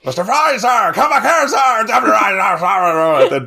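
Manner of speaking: high, loud hammed-up naval bellow; salty sailor shouting. Mocking the film's captain, yelling at his officer. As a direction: bark orders like a ranting ship's captain. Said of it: "incredible salty sailor talk".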